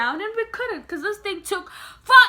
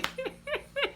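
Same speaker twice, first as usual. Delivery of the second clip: laugh; 11 semitones higher, 11 dB quieter than usual. Real, not acted. Laughing after imitation.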